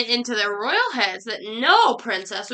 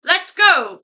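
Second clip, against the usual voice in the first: loud, higher, eager shout; lightly quacked call; 5 semitones higher, 7 dB louder than usual. A narrator's reading. Ducky Lucky rallying to tell the president, quacked call. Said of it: "quacked Ducky Lucky".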